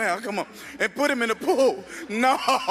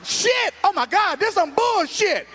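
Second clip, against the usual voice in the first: high, loud shocked cursing; shocked outburst; 9 semitones higher, 3 dB louder, slower than usual. Retold or imitated. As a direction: yelp in disbelief. Shocked reaction in church.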